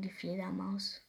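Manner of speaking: usual speaking voice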